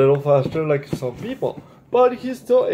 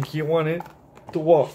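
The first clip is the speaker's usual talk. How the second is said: ordinary delivery, nothing marked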